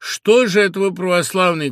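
Vasily pleading loudly and tearfully with the crowd; tearful shout. A narrator's reading. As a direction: cry out to them, near tears. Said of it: "кричит он слезливо".

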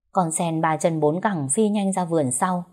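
plain reading voice

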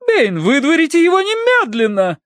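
high, wailing order; panicked command. Acted. Mistress to butler about the dog, panicked command. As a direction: cry out hysterically in a high matron's voice.

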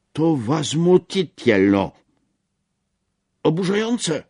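a high, loud, slow cry of outrage; outraged exclamation. A narrator's reading. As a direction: spit out the indignation slowly and loudly. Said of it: "wykrzyknął pierwszy".

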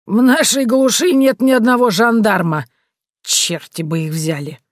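put-on hoarse old man laughing it off; breathless old speaker. A narrator's reading. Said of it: "старик хрипло рассмеялся". (хриплый старик)

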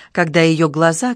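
ordinary delivery, nothing marked

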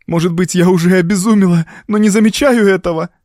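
high, agitated woman's outburst; near hysteria. A narrator's reading. Evelyn fears she has gone mad, high and agitated. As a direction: let the panic push the pitch up.

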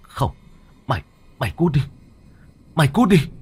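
loud, high panicked shouting; terrified scream. Acted. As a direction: scream in terror at the ghost. Master screams at ghost.

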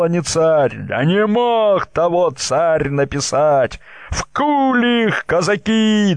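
high, slow angry yelling at the crowd; furious shouting. A narrator's reading. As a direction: roar it out in fury. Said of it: "Взлетели запальные крики".

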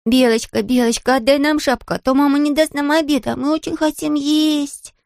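put-on imploring high child voice; pleading girl. A narrator's reading. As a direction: plead sweetly in a high little voice, climbing as you go. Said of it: "а Мина умоляла".

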